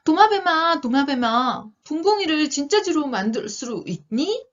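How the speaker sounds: high, pleading storybook call; acted cry for help from a magic creature. A narrator's reading. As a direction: call out urgently with a wish. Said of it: "있다가 외쳤습니다".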